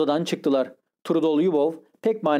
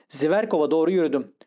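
ordinary delivery, nothing marked

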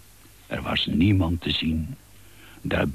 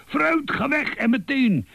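shouting. Acted. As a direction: yell him out of the house. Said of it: "schreeuwde Uil".

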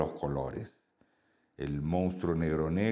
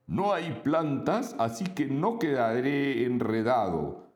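high, cheerful little monster voice; a small happy monster reasoning aloud; friendly monster voice; 4 semitones higher than usual. Acted. Voz del monstruo en el cuento. (voz aguda de monstruito alegre)

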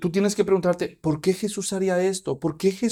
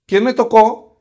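loud, higher call of who touched me; 6 semitones higher, 10 dB louder than usual. Acted. Jesús stops mid-crowd and calls out loudly. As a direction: shout it over the crowd. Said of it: "Imagínense lo que pasó".

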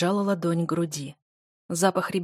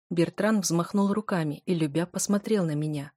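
ordinary delivery, nothing marked

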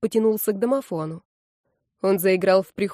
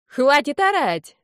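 higher, louder, drawn-out yell; scolding shout; 7 semitones higher, 5 dB louder, slower than usual. A narrator's reading. Someone telling the noisy man off, shouting. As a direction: shout down to silence him.

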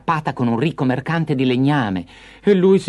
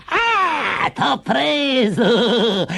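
high, long-drawn witch shriek of joy; gleeful shriek; 12 semitones higher, much slower than usual. Acted. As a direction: screech it in triumph, stretching the words. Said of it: "la vecchia strillò di gioia". (strillo di gioia da strega)

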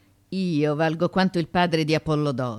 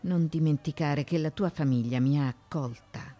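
normal talking voice

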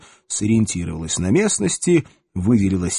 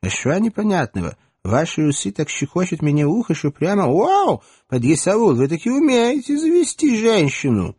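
put-on Odessa accent; 8 semitones higher than usual. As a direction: purr it like an Odessa flirt. Odessa-style flirting woman.

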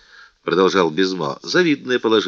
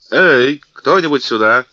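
loud call across the yard; 5 semitones higher, 7 dB louder than usual. A narrator's reading. D'Artagnan calls the musketeers over, loud call. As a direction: summon the guards, loud.

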